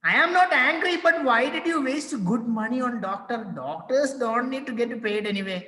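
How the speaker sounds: high, strained protest; exasperated husband. Acted. Husband protests to tearful wife, high and exasperated. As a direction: insist you're not angry while clearly annoyed.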